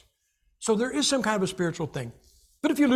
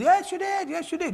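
insistent contradiction; 11 semitones higher than usual. Acted. Preacher rebutting a doubter about Bible reading, high insistence. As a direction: argue back in a much higher voice. Preacher argues with imagined objector.

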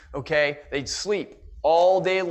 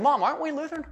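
put-on boy asking mom; 8 semitones higher, faster than usual. Young Ben asking his mother.

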